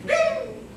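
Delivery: a high, sharp mock bing; bing sound effect. Teen recalls the club hitting the ball, high ping. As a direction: pop the word out high like a ringing club.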